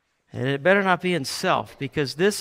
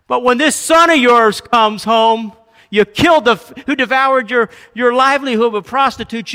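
loud, raised-pitch angry tirade; letting him have it; 6 semitones higher, 9 dB louder than usual. Acted. Elder brother rebuking his dad over the party, angry and loud. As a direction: build from bitter complaint into loud accusation. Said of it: "he lets him have it".

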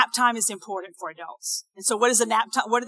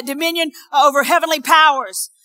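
ordinary talk, nothing marked

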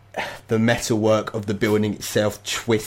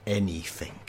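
softer, a knowing, loaded single word; 7 dB quieter than usual. Acted. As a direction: let it hang with meaning.

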